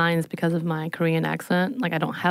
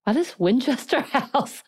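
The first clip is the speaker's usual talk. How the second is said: baffled; 8 semitones higher, slower than usual. Retold or imitated. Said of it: "I was like".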